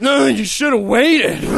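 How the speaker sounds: slow, dismissive clerk voice; lightly voiced snotty store kid; mock smug clerk. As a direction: drag it out like a smug salesman. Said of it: "Don't be like".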